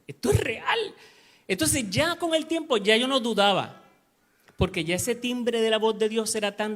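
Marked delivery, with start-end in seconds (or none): 0.1-0.8 high, lifted burst of realization; amazed exclamation; retold amazement. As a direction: exclaim it in wonder, pitch jumping up. Retells his amazed realization.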